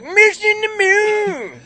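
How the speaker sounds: garbled ad slogan. Retold or imitated. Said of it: "it really just sounds like".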